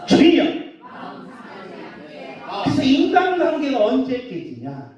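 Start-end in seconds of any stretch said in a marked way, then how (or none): 0.1-0.4 loud, high prayer shout; crying out. Real, not acted. Preacher calling on the Lord with the congregation, crying out. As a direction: throw the call up to heaven.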